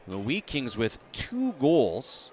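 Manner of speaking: usual speaking voice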